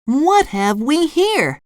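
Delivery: high, slow, curious cartoon voice; SpongeBob. A narrator's reading. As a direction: draw it out with high-pitched curiosity. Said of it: "SpongeBob said".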